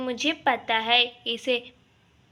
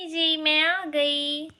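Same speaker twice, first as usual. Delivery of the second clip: slow sugary bahu voice; drawn-out sweet entrance; mock sweet announcement; sweet daughter-in-law; 4 semitones higher, much slower than usual. Bahu arrives, sing-song.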